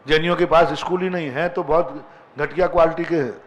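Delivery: usual speaking voice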